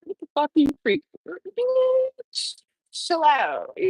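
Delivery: fast, then a sudden high squeal on bitch; mock inner freakout. Her inner voice scolding her for sitting back, with a high squeal. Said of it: "like, I'll sit back and".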